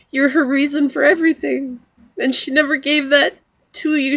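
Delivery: real tearful voice, pitched high; high, emotional, holding back crying; smiling and crying. Real, not acted. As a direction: deliver loving reassurance through tears. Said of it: "smiling and crying at the same time".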